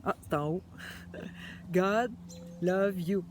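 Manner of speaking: ordinary delivery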